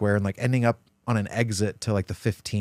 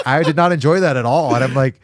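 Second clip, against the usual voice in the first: higher, louder, incredulous complaint; retold exasperated outburst; 6 semitones higher, 6 dB louder than usual. Complaining to his friend after the dab, higher louder exasperation. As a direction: replay the freak-out, pitched up and loud.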